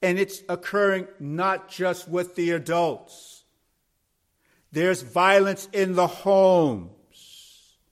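high, slow, emphatic sermon delivery; forceful preaching. Real, not acted. Preacher warns the congregation there is no safe place, forceful preaching. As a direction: preach it out, building to loud, drawn-out phrases. Preacher presses the warning home.

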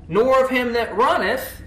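forceful preaching. Acted. Preaching climax.

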